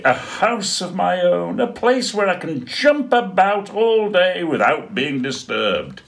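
high, loud, gleeful little penguin; squeaky happy penguin voice; excited penguin Dudley. Acted. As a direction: bounce with joy over his own house. Dudley celebrates his house.

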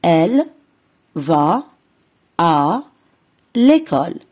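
slow split reading for pupils; word by word repeat. Shown as a demonstration. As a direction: say "elle", "va", "à", "l'école" with pauses between. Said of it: "نعيد".

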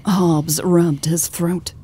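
ordinary delivery, nothing marked

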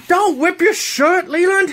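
sharp, high scolding call; scolding shout. Real, not acted. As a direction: yell his name to stop him. Mom scolding son tearing shirt.

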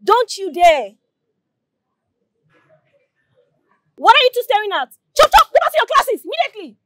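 put-on furious high yell at the girl; angry shouting. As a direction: bark at them to get moving. Prefect yelling at juniors.

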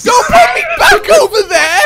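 very high, loud screaming protest; panicked shriek. Real, not acted. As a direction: shriek in protest as you are pushed back. Being knocked back in game.

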